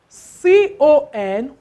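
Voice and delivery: C O N spelled slowly, letter by letter; syllable spelling. Shown as a demonstration. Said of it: "one syllable".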